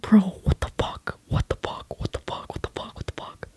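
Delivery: hushed, repeated whisper fading to nearly nothing; unnerved whispering, trailing away; panicked whisper. Retold or imitated. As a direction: whisper in mock panic to a friend. Panicked muttering on seeing her.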